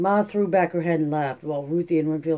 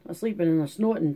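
ordinary delivery, nothing marked

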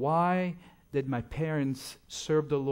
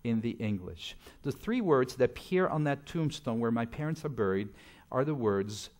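normal talking voice

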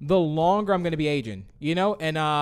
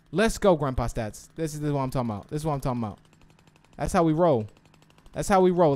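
ordinary talk

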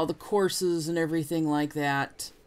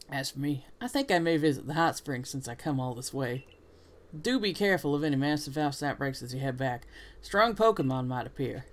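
put-on southern accent. Rival warning of outbreaks before leaving, southern accent. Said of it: "what it is about a southern accent".

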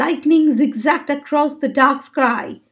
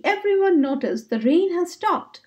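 ordinary delivery

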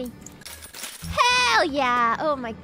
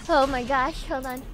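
ordinary delivery

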